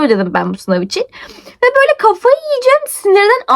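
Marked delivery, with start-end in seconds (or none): 1.5-3.5 much higher, fast frantic outburst; high, rushed near-breakdown. Retold or imitated. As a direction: sound like you're about to lose it. Retelling losing her mind.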